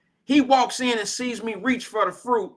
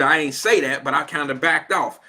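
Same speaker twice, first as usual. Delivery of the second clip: normal talking voice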